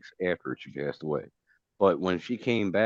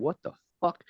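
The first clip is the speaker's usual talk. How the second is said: stunned disbelief; 12 semitones higher than usual. Retold or imitated. Shocked at mom's death.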